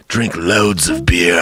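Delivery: slow, eerie Satan-like advice; a hint of a sinister driver; ominous driver. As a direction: drawl it like the devil's calm tip. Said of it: "I really thought ... was, like, Satan".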